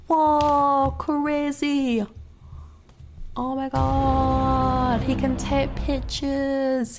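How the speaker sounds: drawn-out, high-pitched mock awe; mock amazement. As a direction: ham up the amazement, stretching the words slowly.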